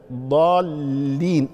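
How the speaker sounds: ضالين very slowly, long "aa" then held "ll"; doubled lam. Shown as a demonstration. Teacher pointing out a shadda, very slow ضالين with doubled lam. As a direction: stretch ضالين out, pressing the geminated lam. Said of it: "مشددة".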